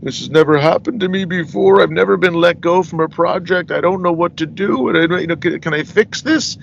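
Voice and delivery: a touch of a timid producer pleading; sheepish. Retold or imitated. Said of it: "he was very sheepishly like".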